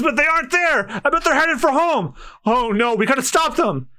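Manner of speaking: high, urgent worried voice; panicked. Acted. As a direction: rush it like a scared villager. Panicked villagers see pirates heading.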